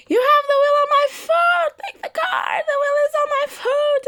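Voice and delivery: high, loud imitation of dad screaming. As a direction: shriek in pain, mimicking father. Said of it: "he starts screaming like".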